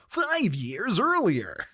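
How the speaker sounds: hammed-up American caption voice, very slow. Shown as a demonstration. Asking if film titles are read this way, exaggerated American voice. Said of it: "Do you read that as".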